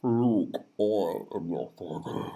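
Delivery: slow, low, soft villain line; low and drawn-out, like a dark father; hushed, slow, ominous impression; deep movie villain. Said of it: "Nah, that's terrible".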